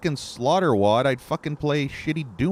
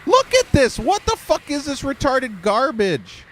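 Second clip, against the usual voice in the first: much higher and louder, real rage; furious shout; 13 semitones higher, 5 dB louder than usual. Real, not acted. Gamer raging at the game's unfair spawn, loud furious shout. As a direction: yell it at the screen in disbelief.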